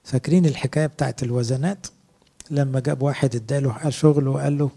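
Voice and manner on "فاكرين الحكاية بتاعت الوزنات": ordinary talk, nothing marked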